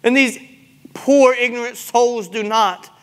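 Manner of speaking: impassioned rebuke from the pulpit; full-force preaching. Real, not acted. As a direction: press the congregation hard, stretching key words.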